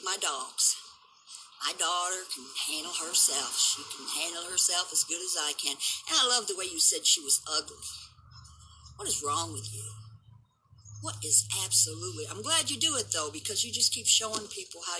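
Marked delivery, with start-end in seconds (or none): none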